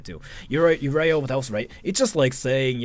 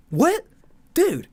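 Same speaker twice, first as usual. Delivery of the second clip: much higher, stunned "What? Dude"; mock disbelief; 13 semitones higher than usual. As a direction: squeak it out in disbelief.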